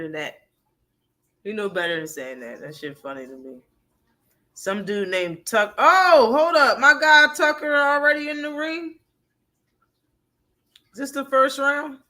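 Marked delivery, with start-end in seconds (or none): 5.8-12.0 sudden higher, louder surprise; surprised. Real, not acted. Spotting his guy Tucker in the ring, surprised. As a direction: perk up, caught off guard. Surprise at seeing fighter on stream.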